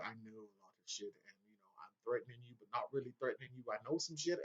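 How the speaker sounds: hint at a threat with quiet menace; Varys. Retold or imitated. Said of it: "he's doing the Varys thing where".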